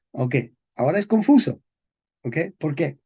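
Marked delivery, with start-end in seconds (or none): none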